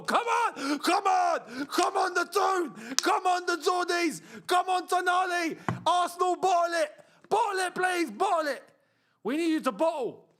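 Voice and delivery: loud, high chanting cheer; fan shouting. Real, not acted. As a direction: roar them on from the sofa. Cheering the final minutes.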